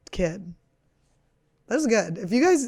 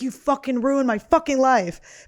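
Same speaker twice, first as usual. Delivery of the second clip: put-on bratty kid yelling; 5 semitones higher, 5 dB louder than usual. Imagined kid blaming her life.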